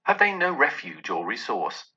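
usual speaking voice

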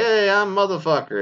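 mock goofy intro. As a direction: play the kid proudly saying his name.

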